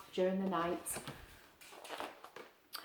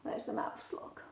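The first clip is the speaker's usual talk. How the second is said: whisper; 13 dB quieter than usual. Real, not acted. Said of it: "whispered aside to child".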